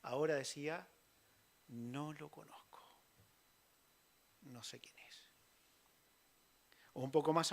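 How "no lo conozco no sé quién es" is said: quiet denial. Acted. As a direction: mumble the denial, eyes down.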